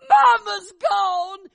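very high, slow, piercing scream; panicked scream. Retold or imitated. Sister finding the bed empty, screaming in panic. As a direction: scream it out in terror. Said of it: "Elsie screamed".

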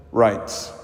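normal talking voice

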